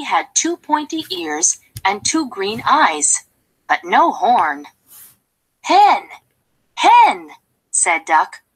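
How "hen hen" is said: high, louder call of Hen. Acted. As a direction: call her name across the yard. Duck calls hen.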